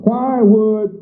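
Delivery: usual speaking voice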